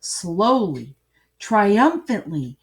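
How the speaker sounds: usual speaking voice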